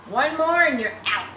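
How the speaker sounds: high, loud taunting warning. Acted. Mary warning Harry, loud taunt. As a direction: threaten him with the last strike.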